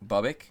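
Bubic as "BUH-bik", questioning; trying a pronunciation. Real, not acted. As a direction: say Bubic with "bub", rising at the end.

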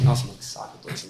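put-on very soft, voiceless child's grumble; imitated sulky grumble. Bored child lying in church, grumbling to himself under his breath.

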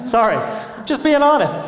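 sheepish laughing; laughing apology. Real, not acted. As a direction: giggle through the confession.

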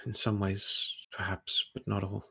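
soft, hesitant, guarded woman; hushed answer. Acted. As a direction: let it out quietly, piece by piece.

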